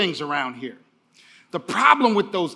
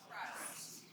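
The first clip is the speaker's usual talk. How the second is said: whisper; 27 dB quieter than usual. Shown as a demonstration. Audience answer whispered.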